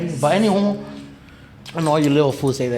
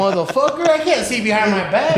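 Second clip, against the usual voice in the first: higher and louder, laughing through it; laughing incredulity; 8 semitones higher, 4 dB louder than usual. Real, not acted. Cracking up about the police question, a high, loud laughing outburst. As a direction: laugh at how absurd it was.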